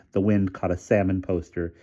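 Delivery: usual speaking voice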